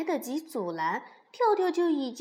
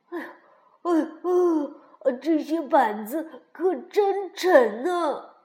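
straining; 4 semitones higher, 7 dB louder than usual. A narrator's reading. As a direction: heave out the words as if lifting. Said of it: "跳跳累得哼哧哼哧的". (累得哼哧哼哧地说)

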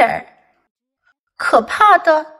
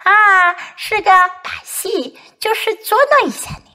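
high-pitched, gleeful reveal; lightly acted amusement; laughing; 7 semitones higher, faster than usual. A narrator's reading. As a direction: tease like a child owning up to a prank. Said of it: "奇博大笑起来".

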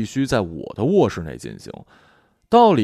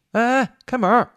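calling through door; 13 semitones higher than usual. Acted. Roommate knocking at the locked door, high impatient call.

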